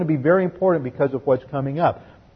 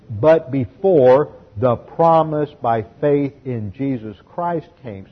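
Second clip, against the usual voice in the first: slow expanded reading; slower than usual. Shown as a demonstration. Expanded paraphrase read out.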